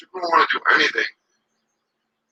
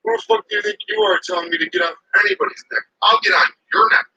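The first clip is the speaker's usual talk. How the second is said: mock angry cop; 7 semitones higher than usual. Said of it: "he was like".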